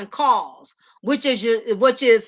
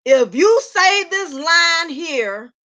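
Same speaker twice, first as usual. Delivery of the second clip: slow emphatic warning; 3 semitones higher, slower than usual. Real, not acted. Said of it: "So listen".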